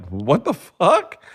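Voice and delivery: slow, high, drawn-out disbelief; mock bafflement. Imitating Russians hearing a man call himself a car, baffled.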